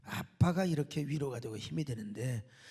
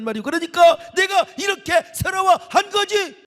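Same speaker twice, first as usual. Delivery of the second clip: put-on loud, high sobbing complaint of a hurt child; child shouting his grievance; son's wailing cry; 12 dB louder than usual. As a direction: wail it like a wronged little boy. Son wailing about the accident.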